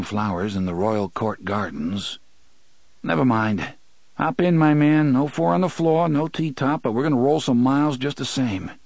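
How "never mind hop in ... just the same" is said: jaunty and raised, streetwise swagger; cocky Eddie voice. A narrator's reading. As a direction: toss it off like a brash kid. Eddie's cocky reply, voiced louder.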